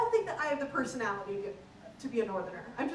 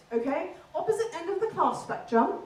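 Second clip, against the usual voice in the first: ordinary talk, nothing marked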